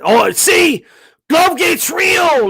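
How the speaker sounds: high, loud, dragged-out mock yell; hammed-up fanatic crowing; imitating a gloating fan, shouting high and slow. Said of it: "you know, saying".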